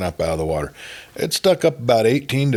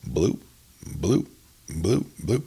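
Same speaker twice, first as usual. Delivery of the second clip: imitated water bloop; 5 dB quieter, slower than usual. A narrator's reading. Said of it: "we both heard it again".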